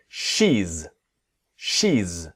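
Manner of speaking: "she's" said as a single syllable with a buzzing z; one quick beat on "she's". Shown as a demonstration. Teacher modelling "she's" in one syllable for students. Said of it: "One syllable".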